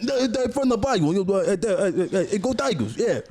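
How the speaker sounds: put-on high, stammering Cajun accent; slow, slurred, hammy Cajun. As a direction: babble in a badly faked Cajun accent. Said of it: "I don't think Cajun accents sound like that".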